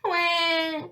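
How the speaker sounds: put-on high-pitched "wah" of a baby shark; baby's yelled wail. A narrator's reading. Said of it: "yelled Finn".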